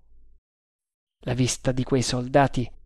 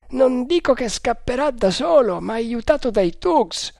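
a higher voiced guard, confident; overheard guard; 10 semitones higher than usual. A narrator's reading. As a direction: play the first guard, sure of himself. Guard worried. (guardia sicura di sé, voce più alta)

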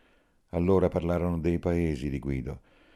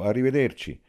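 raised, higher call of goodbye; 8 semitones higher, 6 dB louder than usual. A narrator's reading. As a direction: call it out loudly from the door. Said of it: "sulla porta gridò". (gridato dalla porta)

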